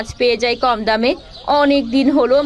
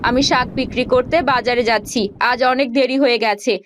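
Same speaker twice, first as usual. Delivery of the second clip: normal talking voice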